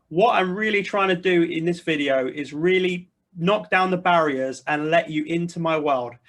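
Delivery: ordinary talk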